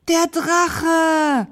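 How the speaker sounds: high, loud, stretched-out warning; alarmed cry. A narrator's reading. Knight spots the dragon behind him, crying out. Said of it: "rief der Ritter".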